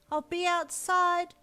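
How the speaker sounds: wife calling loudly through the open door. Acted. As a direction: call it back into the house. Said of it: "you called loudly".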